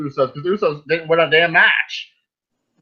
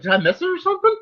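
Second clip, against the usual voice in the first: puzzled disbelief; faster than usual. Retold or imitated. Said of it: "I was like".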